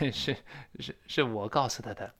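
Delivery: soft, low, hesitant admission; embarrassed, softly acted confession; sheepish confession. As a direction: own up reluctantly, stumbling on the first word. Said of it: "不好意思，我得承认".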